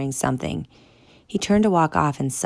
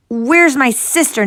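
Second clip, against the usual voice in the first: high loud yell; 10 semitones higher, 9 dB louder than usual. Acted. Boy yelling at guards about his sister, loud. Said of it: "Noah yelled".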